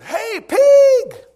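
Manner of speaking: loud, high-pitched taunting yell; heckling shout. Retold or imitated. Kid heckling a cop from afar, loud jeering shout. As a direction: holler it out across the street. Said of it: "when I heard".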